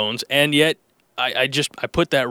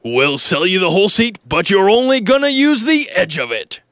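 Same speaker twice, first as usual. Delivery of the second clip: higher and louder, booming ad voice; big announcer read; 6 semitones higher, 5 dB louder than usual. Shown as a demonstration. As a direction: sell the line like a radio announcer, loud and high. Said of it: "follow up, same line".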